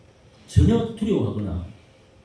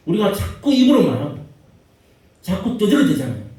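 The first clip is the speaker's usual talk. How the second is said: preaching at full force; 7 semitones higher, 8 dB louder than usual. Acted. Preaching climax, rebuking chatter.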